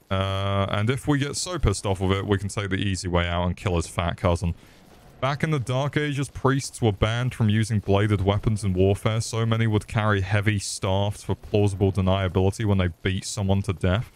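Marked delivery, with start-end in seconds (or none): none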